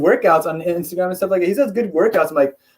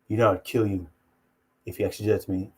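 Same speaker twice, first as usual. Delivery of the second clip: rival fighter's threat; 7 semitones lower, 7 dB quieter, faster than usual. Retold or imitated. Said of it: "He's like".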